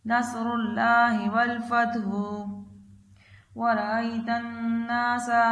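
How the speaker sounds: slow, drawn-out tajweed chant, phrase by phrase; Quran recitation. Acted. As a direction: chant each phrase slowly for the child to repeat. Third recitation of the surah.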